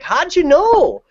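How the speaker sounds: high, slow Canadian put-on; imitated Canadian accent. Concertgoer asked if she's from Canada, imitated Canadian accent. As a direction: drag it out high in mock Canadian surprise. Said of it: "everything you said was Canada".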